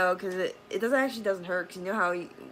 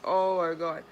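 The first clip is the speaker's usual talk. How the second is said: geeking out; 6 dB louder, slower than usual. Retold or imitated. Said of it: "so geeking out".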